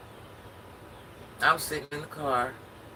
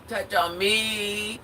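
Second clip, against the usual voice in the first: very slow, higher mock seductive drawl; imitating ex's come-on; 8 semitones higher, 4 dB louder, much slower than usual. Ex propositioning her in the car, mock seductive. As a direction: ham up the ex's cheesy come-on. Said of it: "pulled his pants down and said".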